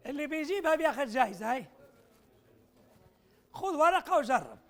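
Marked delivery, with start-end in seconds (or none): 3.5-4.6 slow, deliberate, leaning on ورقة, as if pressing the room; slow emphatic urging. Real, not acted. Lecturer urging audience to grab paper and try, slow and emphatic. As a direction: spell it out slowly so everyone takes paper. Repeating the challenge.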